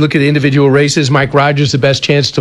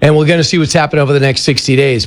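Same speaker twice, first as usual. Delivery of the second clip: ordinary talk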